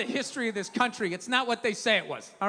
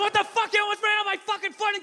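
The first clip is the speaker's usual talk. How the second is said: high mock yell of outrage; hammed-up irate pedestrian; acted angry screaming; 9 semitones higher than usual. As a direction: yell it indignantly, pitch way up. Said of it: "He's screaming at me".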